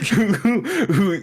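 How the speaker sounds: talking through giggles; laughing. Real, not acted. Telling co-host about the bus gag, breaking up laughing. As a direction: stammer the words out through laughter. Cracking up at own recap.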